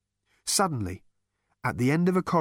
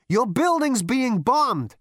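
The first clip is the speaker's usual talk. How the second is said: slow high shout in his ear; 13 semitones higher, 6 dB louder, slower than usual. Acted. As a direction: lean in and shout it. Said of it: "shouted in his ear".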